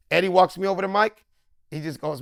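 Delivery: ordinary talk, nothing marked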